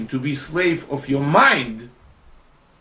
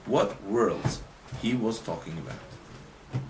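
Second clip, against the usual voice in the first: spaced-out words, tailing off softer; slow, deliberate question; 2 semitones lower, 6 dB quieter, slower than usual. Real, not acted. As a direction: slowly put the question to the class. Question repeated slowly for listeners.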